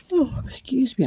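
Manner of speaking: through a yawn. Real, not acted. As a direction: say it mid-yawn. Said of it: "I'm yawning".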